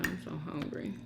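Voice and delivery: ordinary talk, nothing marked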